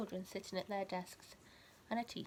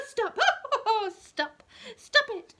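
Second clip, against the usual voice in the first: much higher and louder, laughing through each stop; laughing teacher begging stop; 14 semitones higher, 15 dB louder, slower than usual. Acted. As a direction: beg them to stop while cracking up. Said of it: "the teacher was laughing out loud saying".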